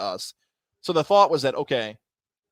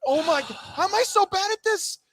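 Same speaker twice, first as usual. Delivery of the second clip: high-pitched mock despair; exasperated. Announcer after botching five names, exasperated mock despair. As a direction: wail at your own failure, hammed-up. Retold exasperation at own mistakes.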